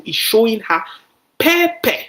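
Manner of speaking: pepper shot up high and loud; a sudden loud, high burst on pepper; emphatic pepper. Real, not acted. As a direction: land the word pepper with a big high yelp. Telling a Nigerian idiom.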